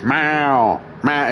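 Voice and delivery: drawn-out, irritating cat yowl; annoying meow. Retold or imitated. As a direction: imitate a nagging cat, long meow. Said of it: "most annoying fucking meow".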